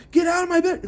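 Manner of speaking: very high-pitched, frantic retold yell. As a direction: re-enact a desperate yell at someone intruding. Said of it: "I keep yelling at him".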